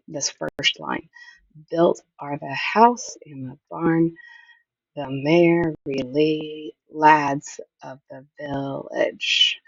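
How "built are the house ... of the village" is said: slow, beat-by-beat reading, leaning on "Built", "house", "barn", "lads"; scanning the meter. Shown as a demonstration. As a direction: punch "Built", "house", "barn", "lads" to show the dactylic pattern. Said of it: "this dactylic pattern with emphasis".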